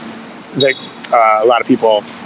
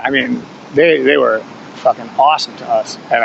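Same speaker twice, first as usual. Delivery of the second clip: ordinary delivery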